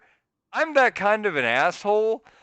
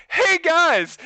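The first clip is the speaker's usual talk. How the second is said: retold laughing call-out; 9 semitones higher, 3 dB louder than usual. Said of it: "he starts laughing, and he goes".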